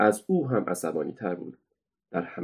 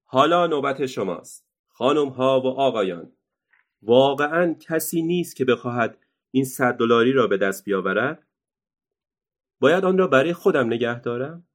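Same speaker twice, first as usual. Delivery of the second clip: louder, higher barker's cry; ringmaster shouting; 5 semitones higher, 4 dB louder than usual. Acted. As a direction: taunt the crowd at full volume. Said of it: "دوباره فریاد زد".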